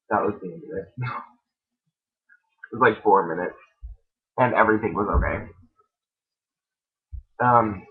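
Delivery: ordinary delivery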